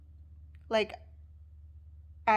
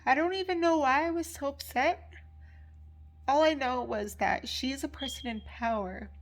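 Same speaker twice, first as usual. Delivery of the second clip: real crying, high and loud; 5 semitones higher, 4 dB louder than usual. Real, not acted. Student upset about instructor's power, crying. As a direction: talk through tears, pitch pushed up. Crying after a long pause.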